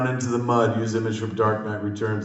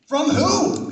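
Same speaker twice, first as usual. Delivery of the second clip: put-on much higher puzzled kid voice; high confused kid; 4 dB louder than usual. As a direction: ask it like a baffled boy. Sidekick reply in script.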